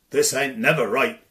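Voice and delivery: loud, indignant country lad; loud rustic protest; Tom's bold voice. Acted. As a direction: declare it like a stubborn Cornish farmhand. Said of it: "said Tom".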